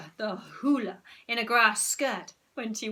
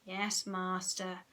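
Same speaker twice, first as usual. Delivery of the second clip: slow, soft, lower trance voice; hypnotised monotone; 3 semitones lower, 6 dB quieter, much slower than usual. Acted. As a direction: drone it like a zombie. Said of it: "said Moody Margaret".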